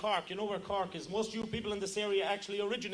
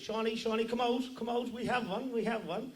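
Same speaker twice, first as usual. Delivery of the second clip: higher, excited Cork call; Cork accent; 4 semitones higher than usual. Acted. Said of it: "the little Cork man says".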